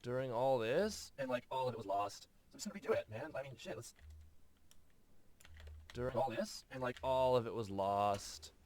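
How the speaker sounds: skater surfer voice. Acted. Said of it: "it's too skatery anyway".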